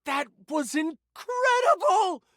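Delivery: high, drawn-out amazed exclamation; thrilled. Acted. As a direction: gush in astonishment, stretching the words out. Thrilled reaction to the game.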